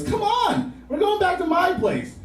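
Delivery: put-on high-pitched girlfriend voice; imitated girlfriend. Girlfriend calls to boyfriend from train.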